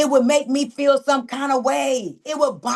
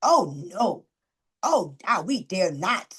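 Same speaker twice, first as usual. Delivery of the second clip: lower, hammed-up dismayed quote; mock dismay; 6 semitones lower, slower than usual. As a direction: act out mock panic, lower and hushed. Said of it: "I said".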